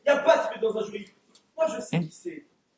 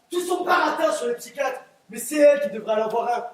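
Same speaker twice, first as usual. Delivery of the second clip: ordinary delivery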